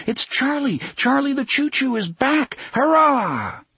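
put-on very high, cheering kids; excited children's cries. A narrator's reading. Children cheer the old train's return, excited cries. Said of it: "they cried".

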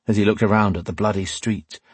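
ordinary delivery, nothing marked

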